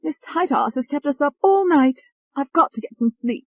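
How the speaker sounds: put-on high, peevish English-accented complaint; English-accented Tony. A narrator's reading. Tony complains of being kept up, high English accent. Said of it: "There was that English accent".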